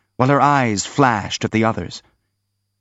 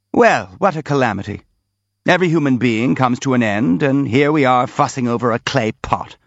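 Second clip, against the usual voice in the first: ordinary delivery, nothing marked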